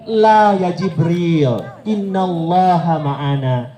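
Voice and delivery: slow gentle reply. Retold or imitated. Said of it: "dijawab sama Rasulullah".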